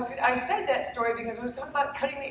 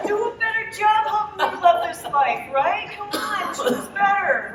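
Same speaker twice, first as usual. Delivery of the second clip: loud, high, hammed-up scolding; mock-furious pleading at full volume; pissed off; 8 semitones higher, 11 dB louder than usual. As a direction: berate the neighbor in comic exasperation. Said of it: "I'm really pissed off at my neighbor".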